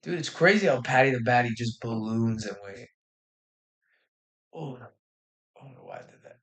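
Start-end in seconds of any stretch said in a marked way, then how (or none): 4.5-6.4 embarrassed whisper to himself; whispered aside. Real, not acted. Streamer muttering to himself after a slip, whispering. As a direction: breathe it out, barely audible.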